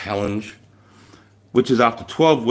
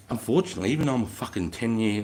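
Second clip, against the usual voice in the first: ordinary talk, nothing marked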